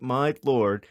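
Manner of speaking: usual speaking voice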